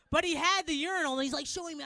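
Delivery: ordinary talk, nothing marked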